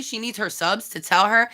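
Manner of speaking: usual speaking voice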